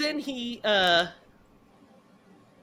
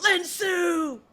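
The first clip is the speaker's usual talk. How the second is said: mock cry out; 11 semitones higher than usual. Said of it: "Hartley's like".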